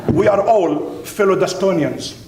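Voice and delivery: ordinary talk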